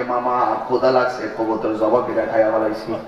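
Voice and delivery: low, meek nephew admitting he ate them; nephew answering. Retold or imitated. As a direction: confess sheepishly in a low voice. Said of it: "ভাগিনা ডাক দিয়ে কে".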